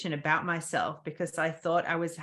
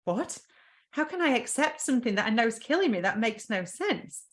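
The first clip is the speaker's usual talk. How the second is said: incredulous; 6 semitones higher than usual. Retold or imitated. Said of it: "And I'm like".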